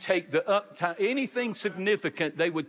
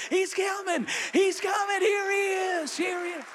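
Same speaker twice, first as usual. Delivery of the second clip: much higher, shouted call of the heavenly crowd; joyful shout. Retold or imitated. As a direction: hail him at the gates. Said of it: "they start shouting at, at the gates".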